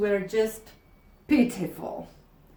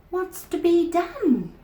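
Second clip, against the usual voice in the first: worried teacher; 9 semitones higher, 5 dB louder than usual. Acted.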